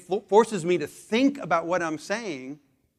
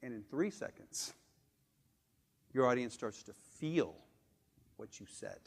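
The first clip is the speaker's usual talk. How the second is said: soft and slow; 5 semitones lower, 11 dB quieter than usual. Shown as a demonstration. Speaker to audience on pauses, much softer. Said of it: "Pauses are a requirement".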